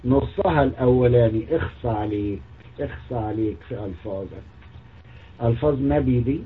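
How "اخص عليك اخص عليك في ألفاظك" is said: low, soft disgusted tut; disgusted tsk. Real, not acted. Scolding the prophet's words, low disgusted tut.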